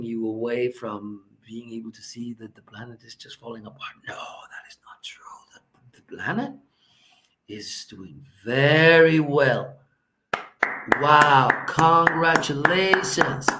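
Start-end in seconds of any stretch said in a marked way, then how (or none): 2.3-5.2 hushed fast aside, then hushed denial; conspiratorial whisper. Pretend. Comic whispering the real truth to the audience. 5.9-13.5 slow and loud, hammed-up congratulations; sarcastic loud praise. Mocking the politicians with slow loud congratulations.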